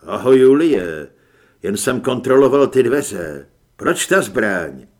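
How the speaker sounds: low, forceful voice of the hero's double; narrator's double. A narrator's reading. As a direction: play the double, deep and loud. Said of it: "odpověď pronesenou mým hlasem".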